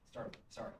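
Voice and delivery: normal talking voice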